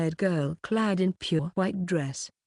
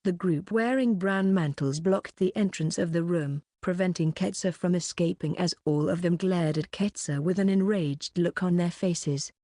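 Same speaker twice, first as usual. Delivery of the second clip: usual speaking voice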